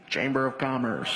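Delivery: very low, disguised voice; put-on official voice. Prankster answers through the door, deep disguised voice. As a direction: drop it deep to pose as an official.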